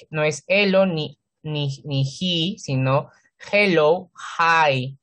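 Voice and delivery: usual speaking voice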